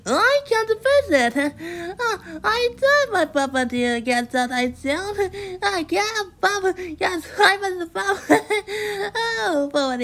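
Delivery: very high-pitched cartoon character voice; Mister Bump. A narrator's reading. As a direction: play the accident-prone visitor in high squeaks.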